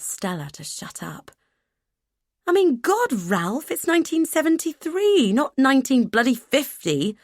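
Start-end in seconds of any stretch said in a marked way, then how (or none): 2.4-7.2 high, loud and exasperated; indignant outburst. A narrator's reading. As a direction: let the outrage spill out. Stella indignant, much higher.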